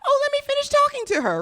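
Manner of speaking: high, fast, prim mock voice; mock polite lady. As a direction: squeak it out like a dainty lady. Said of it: "She's not saying".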